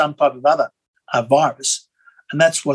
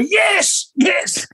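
high, ecstatic re-enacted cheer; cheering like footy fan; much slower than usual. Watching the testimony at night, cheering like a footy fan. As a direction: shout it like your team just scored. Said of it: "jumping up and down like a footy game".

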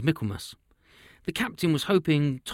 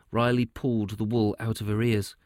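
ordinary delivery, nothing marked

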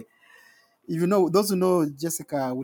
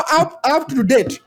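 laughing; 10 semitones higher, 4 dB louder, slower than usual. Real, not acted.

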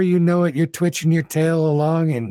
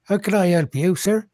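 raised, polite tavern keeper; a higher, obsequious barkeep serving guests; bartender voice; 6 semitones higher than usual. Acted.

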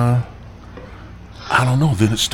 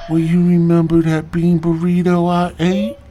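sheepish loud; 8 semitones higher, 6 dB louder than usual. Retold or imitated. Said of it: "she's like".

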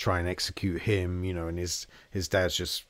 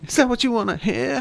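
much higher, louder staccato quote; Walken impression; 7 dB louder than usual. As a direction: clip each word like Walken. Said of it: "Walken-esque".

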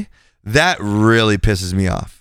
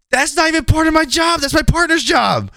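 very high-pitched, exasperated yelp; mad outburst. Retold or imitated. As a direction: retort in mock outrage, voice shooting way up. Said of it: "I got mad".

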